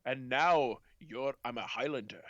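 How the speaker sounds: slow Scottish film-star impression; Sean Connery. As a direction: take it slowly, with "sh" for every s. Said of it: "he's just being Sean Connery".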